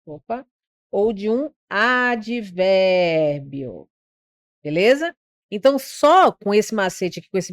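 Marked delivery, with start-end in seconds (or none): 1.7-3.1 advérbio pulled out long, syllable by syllable; slow, elongated teaching emphasis; drawn-out key term. Shown as a demonstration. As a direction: stretch the vowels of advérbio slowly.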